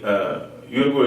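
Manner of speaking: ordinary talk, nothing marked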